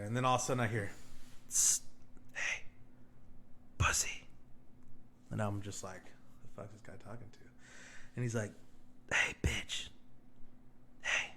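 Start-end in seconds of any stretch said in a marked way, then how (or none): 1.5-4.2 hissed taunt, all breath and no voice; mock whisper. Classmate taunting from behind. 6.4-7.4 soft, lower unvoiced aside; whispered aside. Inner reaction to the taunt. 9.1-11.3 breathy whispered taunt; mock whisper. Classmate taunting from behind.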